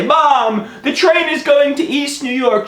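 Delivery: high-pitched panicked wail. Retold or imitated. Son phoning his mom from the train, high panic. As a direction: cry out to mom in acted panic. Panicked phone call to mother.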